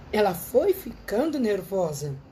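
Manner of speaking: slowed and stretched out for emphasis; savoring each word, slow; slow, drawn-out repeat. Retold or imitated. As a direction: drag the words out to stress how nervous she got.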